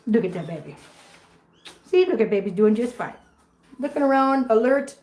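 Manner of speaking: ordinary delivery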